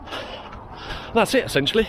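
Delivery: breathless, airy and strained; gasping between words while running; out of breath. Real, not acted.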